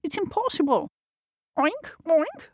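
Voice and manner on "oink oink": high squealy oink oink. Acted. The horse oinking at the animals, high. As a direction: oink like a piglet. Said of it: "said the horse".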